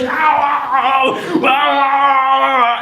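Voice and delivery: high yelping ows; devil in thorns. Acted. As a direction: squeal as the thorns bite. Devil caught in thorn bush.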